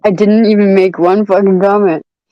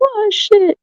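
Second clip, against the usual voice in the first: laughing; 11 semitones higher than usual. Real, not acted. Still crying with laughter.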